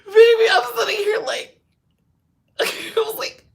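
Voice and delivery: high, loud laugh-choked words. Real, not acted. Telling viewers about the noise, still laughing. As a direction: push words through the laughter.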